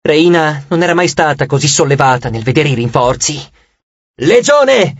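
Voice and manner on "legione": high rallying call; raised battle command, falling at the end; commander's yell. A narrator's reading. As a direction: call the legion to order. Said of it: "urlò".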